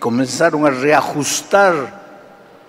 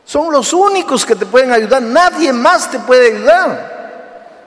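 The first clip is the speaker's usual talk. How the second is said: urgent preaching; 7 semitones higher, 4 dB louder than usual. Acted. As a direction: lean on every word. Sermon climax, urging youth.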